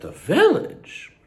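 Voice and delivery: high, slow, dramatic "The village?" questioning squeal; Drag Race meme. Retold or imitated. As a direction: stretch it out high and incredulous. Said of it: "That's a Drag Race meme".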